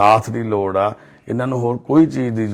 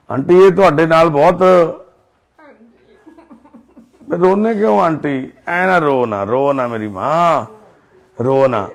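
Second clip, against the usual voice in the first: loud comforting; 7 semitones higher, 8 dB louder than usual. Real, not acted. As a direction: call out warmly but loud so she hears. Comforting the crying aunt loudly.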